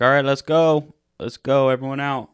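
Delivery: retold call to clear the bar. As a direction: herd the customers out. Bar staff clearing out customers.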